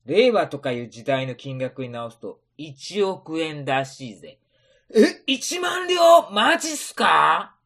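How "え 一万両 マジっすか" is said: high, slow shocked yell; shocked shout. Acted. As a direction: cry out in disbelief. Shocked at ten thousand ryo.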